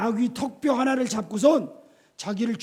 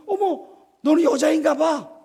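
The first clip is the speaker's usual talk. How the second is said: mocking laugh; 7 semitones higher, 7 dB louder than usual.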